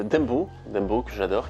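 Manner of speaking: normal talking voice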